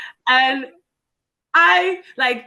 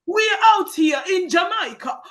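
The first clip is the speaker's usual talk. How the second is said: high, shouted cartoon Jamaican accent; Sebastian the Crab; 9 semitones higher than usual. Parodying how people think Jamaicans talk, cartoon Jamaican accent. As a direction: call out in a mock Jamaican accent. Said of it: "this is Sebastian the Crab".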